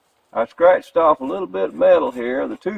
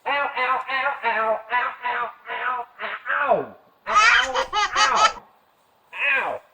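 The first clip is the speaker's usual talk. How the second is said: high laughing yelps of pain; burnt fingertips; 10 semitones higher, 3 dB quieter, slower than usual. Real, not acted. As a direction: yelp ow while half laughing. Hot bulb again, laughing.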